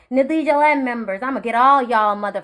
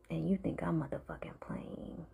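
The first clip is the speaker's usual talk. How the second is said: hushed, breathy threat; menacing whisper; 7 semitones lower, 18 dB quieter than usual. Real, not acted. Warning Hilda her downfall has begun, menacing whisper. Threatening the president after curse.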